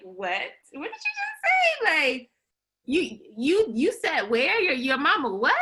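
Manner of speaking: high, stunned what did you just say; playful mock outrage, voice jumping way up; shocked disbelief. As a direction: act floored by what you just read. Shocked reaction to bold opening.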